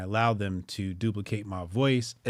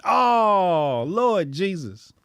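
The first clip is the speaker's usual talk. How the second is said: exasperated; 7 semitones higher, 6 dB louder, much slower than usual. Retold or imitated. Dismay at the lesson.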